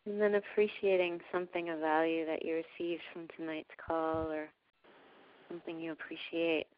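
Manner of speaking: calm, unhurried meditation voice with long pauses; guided meditation. Real, not acted. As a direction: gently guide listeners into breathing, softening as you go. Leads a heart-breathing meditation.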